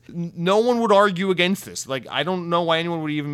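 loud, high-pitched exasperated protest. Real, not acted. Podcaster ranting at FIFA's dumb quote, exasperated. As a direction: raise your voice in disbelief at the argument.

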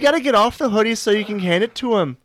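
high, fast, hammed-up Wisconsin accent. Scolding someone sitting on the hoodie, high Wisconsin accent. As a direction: rattle it off as a high-pitched Wisconsinite. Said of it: "all my Wisconsin accents".

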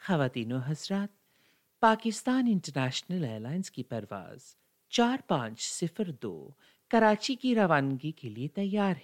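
brisk Urdu cabin announcement, fast; PIA air stewardess. Shown as a demonstration. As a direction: rattle off the boarding call like cabin crew. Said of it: "I can sound like an air stewardess who works on Pakistan International Airlines".

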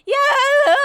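screamed sing-along shout, high and loud; belting yell. Retold or imitated. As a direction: yell it like the third time through the chorus. Yelling the song at concert.

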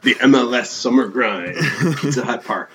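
booming monster truck announcer. Pretend. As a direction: hype it like a Sunday monster truck ad. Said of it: "That's the monster truck voice".

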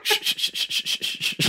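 urgent unvoiced shh; whispered shushing. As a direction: hush someone frantically. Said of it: "I was just like".

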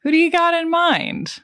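lightly acted movie line, pitched high; a hint of character in a high-pitched question; Ruben. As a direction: quote a crew boss's question, voice lifted high. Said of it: "the wonderful line from Ruben".

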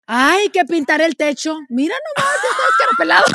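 wife distracted, complaining about peeling paint; bored wife. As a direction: whine about the ceiling mid-act. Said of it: "Y la esposa dice".

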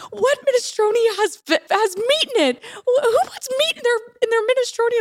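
high squealing outrage; freaked-out sister. Retold or imitated. Sister mimicking her outraged minestrone complaint. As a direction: mimic her shock at meat in the soup. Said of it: "And you're like".

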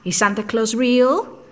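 lightly voiced little kid pestering his parents; a hint of a curious kid; child's voice. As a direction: ask like an innocent, nosy child. Imitating himself as a child.

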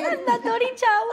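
laughing while echoing mandatory shower; amused laughing echo; laughing repeat. Real, not acted. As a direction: giggle through mandatory shower. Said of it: "laughs while repeating".

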